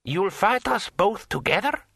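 high, rising disbelief; astonished query; incredulous. A narrator's reading. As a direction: question it as if it were absurd. Incredulous challenged lord.

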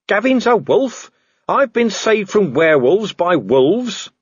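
slow, high-pitched disbelief; incredulous. Acted. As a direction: draw it out, astonished at the news. Vimes learns wolves saved him.

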